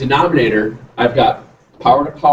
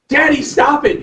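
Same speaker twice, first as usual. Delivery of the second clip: put-on much higher, pleading kid's whine; imitating whiny son; 11 semitones higher than usual. Son begging his teasing dad to quit, in a high kid's whine. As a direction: whine it like a frustrated little boy.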